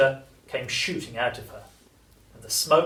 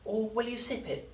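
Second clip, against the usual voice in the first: put-on high, soft voice for a young character; storybook grandson, pitched up and softened; George asking; 9 semitones higher, 5 dB quieter than usual. A narrator's reading. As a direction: read the question high and lightly in George's voice.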